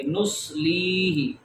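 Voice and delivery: نسلیہ slow, with lee held long; long vowel. Shown as a demonstration. Teacher drills ya madda, نسلیہ drawn out. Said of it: "اس کو لمبا کریں گے".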